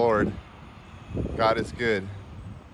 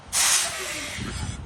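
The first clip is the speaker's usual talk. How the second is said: slow, trembling with nerves; shaky, stretched-out amazement; shaky awed voice; much slower than usual. Real, not acted. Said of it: "Sorry if I'm shaking".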